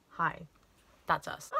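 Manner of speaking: ordinary talk, nothing marked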